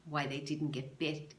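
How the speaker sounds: plain reading voice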